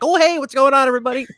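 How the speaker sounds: put-on cheery, high-pitched hello; cheery greeting. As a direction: act out a bubbly hello to the room. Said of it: "here with the cheery sweater on".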